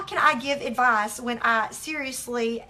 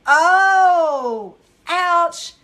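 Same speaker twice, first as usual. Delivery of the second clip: loud, drawn-out groaning regret; big theatrical ouch; mock wince; 4 semitones higher, 10 dB louder than usual. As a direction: wail it as if stung by your own mistake. Wincing at her own outburst.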